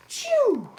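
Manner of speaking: high loud sneeze-like shout; sneeze burst. A narrator's reading.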